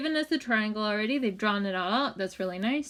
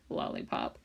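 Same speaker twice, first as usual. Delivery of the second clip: whispered aside; 7 dB quieter than usual. Real, not acted. Aside after naming the symbol.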